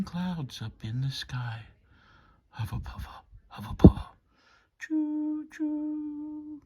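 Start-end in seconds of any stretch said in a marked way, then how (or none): 2.5-4.2 chugging sound. Acted. Train chugging sound in story. 4.8-6.6 high drawn-out choo choo; train whistle. Acted. Bedtime train story, high whistle choo choo.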